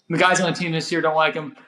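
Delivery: ordinary delivery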